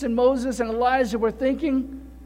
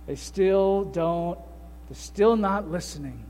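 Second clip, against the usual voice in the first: quiet Jesus; 6 semitones lower, 6 dB quieter than usual. Retold or imitated. Jesus quietly telling the Father in the boat, low and weary. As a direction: lower and softer, weary sigh of a prayer. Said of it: "Jesus just quietly said".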